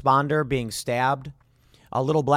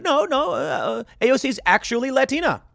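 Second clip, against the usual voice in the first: mock protest; 9 semitones higher than usual. Voices AOC's defenders objecting.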